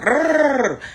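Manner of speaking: high rolling rrrr chant sound; imitated chant. Retelling the ball crowd's chant, high trilled imitation. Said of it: "I had heard".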